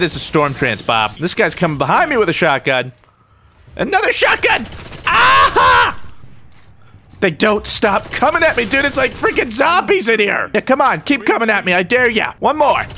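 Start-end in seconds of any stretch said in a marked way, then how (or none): 3.7-4.6 very high-pitched outburst; frustrated gamer squeal; exasperated cry. Real, not acted. Killed by yet another shotgun. 5.1-6.0 loud, very high yell; wild high-pitched shriek; frustrated scream. Real, not acted. 7.2-12.8 high, worked-up taunting; heated gamer in mid-fight; frantic defiance. Real, not acted. Frantic fight against many attackers.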